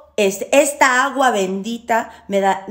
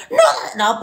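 screaming ritual; 12 semitones higher than usual. Retold or imitated. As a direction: yell it like a witch gone wild at night. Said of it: "no lo ando gritando, así como pinche loca". (bruja gritando su ritual a las diez de la noche)

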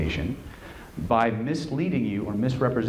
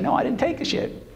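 much higher, exaggerated protest; mock blunt denial. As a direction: protest innocence in a high, hammed-up voice. Acting the blunt denial she avoided.

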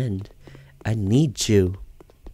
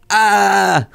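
mock scream; 8 semitones higher, 4 dB louder than usual. Spooked by game object.

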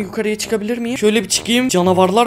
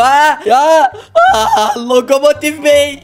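startled shout; 4 semitones higher, 4 dB louder, slower than usual. Real, not acted. Startled by train.

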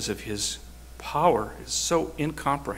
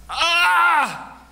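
sudden high cry of pain; yell; 7 dB louder than usual. Retold or imitated. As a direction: cry out sharply, high and loud. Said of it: "I heard Kevin yell".

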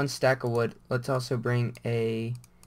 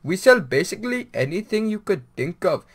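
hammed-up Indian accent, lilting rises on the list; mock Indian shopkeeper; 2 semitones higher, 3 dB louder than usual. Gamer imitates an Indian convenience-store clerk in mock accent. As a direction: rattle off the store's goods as a caricatured Indian clerk. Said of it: "They're like".

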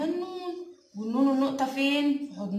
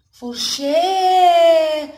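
very slow, louder, each syllable drawn out; syllable-by-syllable stretch; 4 semitones higher, 10 dB louder, much slower than usual. Shown as a demonstration. Teacher to kids, sounding out a word, slow and stretched. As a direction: sound the word out slowly for small children. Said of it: "فر شا".

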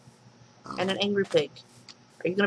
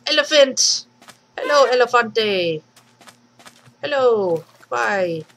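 loud, high delighted hello; excited greeting; 4 semitones higher, 7 dB louder than usual. Real, not acted. To an elephant she spots, loud excited greeting. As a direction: call out to it with delight.

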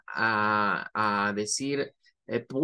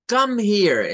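sounds angry; 7 semitones higher, 8 dB louder than usual. Shown as a demonstration. Teacher demonstrates a rude command, angry. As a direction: Come here, barked higher and louder. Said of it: "podría parecer que alguien esté enojado".

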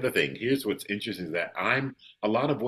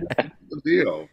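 louder, higher, fed-up venting at the games; mock frustration, what's the deal; imitating his own fed-up self, raised voice; exasperated; 6 semitones higher, 6 dB louder than usual. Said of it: "I'm like".

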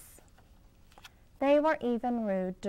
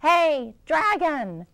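higher, much louder yell; loud shout; 8 semitones higher, 10 dB louder, slower than usual. Acted. Elizabeth shouting into the sleeping dragon's ear, full loud yell. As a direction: yell right into his ear. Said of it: "She shouted as loud as she could".

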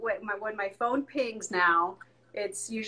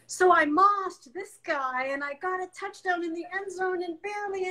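lightly voiced excited boy, higher; a touch of kid excitement; excited son; 8 semitones higher than usual. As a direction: bubble over like a kid after a game. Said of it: "and tells you".

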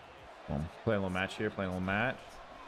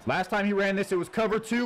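high, loud hyped yell; excited shout; 9 semitones higher, 5 dB louder than usual. Real, not acted. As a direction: repeat it shouting with hype. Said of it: "repeated louder".

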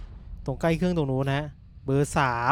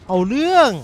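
excited exclaim; 6 semitones higher, 6 dB louder than usual. Real, not acted. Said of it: "เอาเรื่องเอาราว".

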